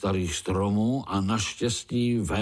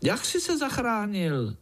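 very high, pressing question; impatient. A narrator's reading. Pája presses uncle about his escape, impatient. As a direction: plead high for the answer. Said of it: "Pája už to nemohl vydržet".

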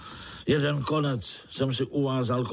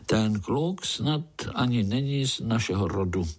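ordinary delivery, nothing marked

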